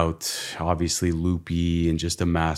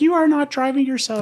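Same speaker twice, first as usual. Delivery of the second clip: insistent wife; 4 dB louder than usual. Retold or imitated. Wife forbids, high.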